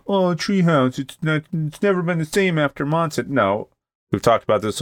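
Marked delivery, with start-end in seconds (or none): 0.1-3.3 high whiny beer snob; lightly mocking the critics; mock complainer. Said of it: "people are gonna hear this and think".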